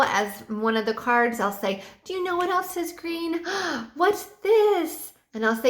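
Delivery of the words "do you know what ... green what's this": much higher, gasping mock wonder; mock surprise. Shown as a demonstration. Teacher revealing a toy dinosaur, mock surprised gasp. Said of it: "bring lots of energy".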